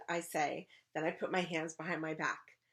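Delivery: usual speaking voice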